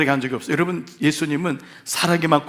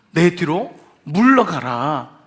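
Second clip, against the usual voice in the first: slow stern command; 3 dB louder, much slower than usual. Retold or imitated. Jesus commands Satan away, slow.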